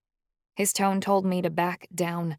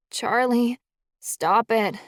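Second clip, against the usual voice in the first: put-on drunken slur; 5 semitones higher, 5 dB louder, slower than usual. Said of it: "I slurred".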